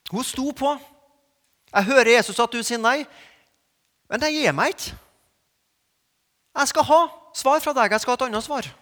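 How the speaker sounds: loud, high, insistent preaching; preacher voicing a woman who won't give up, loud; fervent preaching. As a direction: drive it home with fire.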